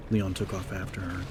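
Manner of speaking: ordinary delivery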